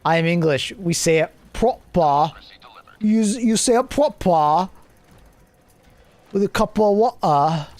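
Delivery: mock British accent over the bottle of water meme; mock English accent. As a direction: play a snooty Englishman saying it proper. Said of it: "which English accent that is".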